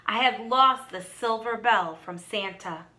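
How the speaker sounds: plain reading voice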